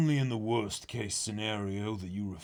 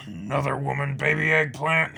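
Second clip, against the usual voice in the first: higher, louder taunting chef; mocking old chef; 6 semitones higher, 8 dB louder than usual. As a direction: needle the boy with glee.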